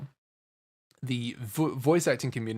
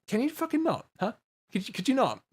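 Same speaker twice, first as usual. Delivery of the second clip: high exasperated rebuke; exasperated plea; 9 semitones higher than usual. Acted. Streamer telling off fake voice actors, exasperated and high. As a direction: plead with them to stop.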